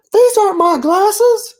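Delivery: very high, slow cartoon catfish in disbelief; dismayed catfish. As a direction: stare at the donuts in horror. Catfish discovers donuts.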